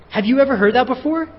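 ordinary delivery

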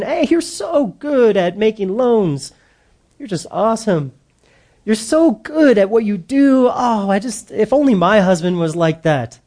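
a hint of a sweet-talking girl at work; flattering coworker. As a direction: gush a little over him, lifted pitch. Man voices a flattering coworker.

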